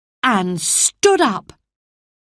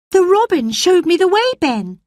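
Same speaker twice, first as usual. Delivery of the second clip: put-on high, fast girl's voice; quick girl Mary; 12 semitones higher, 4 dB louder than usual. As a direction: answer the gardener briskly. Mary's voice.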